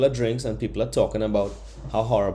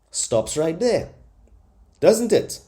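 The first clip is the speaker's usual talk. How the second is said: getting heated; 6 semitones higher, 4 dB louder, slower than usual. Real, not acted. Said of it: "I'm warm now, but I'm getting heated".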